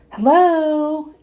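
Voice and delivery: high, loud sing-song greeting; a girl calling out as she steps in; calling hello. Acted. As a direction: call a cheery hello into the house. Addie calls on entering.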